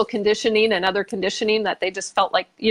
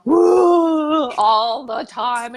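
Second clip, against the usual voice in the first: a louder, higher hammed-up crying wail; mock sobbing wail; 5 semitones higher, 5 dB louder, much slower than usual. Retelling crying at the English funeral, mock wail. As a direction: play the endless crier for laughs. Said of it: "Why am I crying so hard?".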